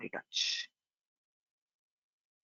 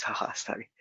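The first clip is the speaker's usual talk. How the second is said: nervous laugh; 7 dB quieter than usual. Real, not acted. Fumbling the screenshot shortcut.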